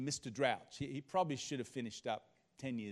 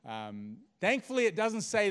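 normal talking voice

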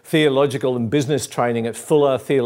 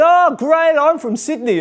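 high, excited Aussie, loud at the start; hammed-up delighted Aussie accent; 4 dB louder than usual. Sydney driver meeting someone from Melbourne, excited Aussie accent. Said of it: "it's an Aussie accent".

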